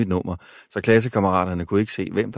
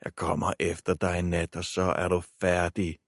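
quiet menace; 5 dB quieter than usual. A narrator's reading. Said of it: "vrængede Jimmy".

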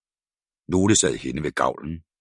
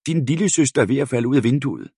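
shouted warning; 8 semitones higher, 3 dB louder than usual. A narrator's reading.

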